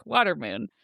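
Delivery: ordinary talk, nothing marked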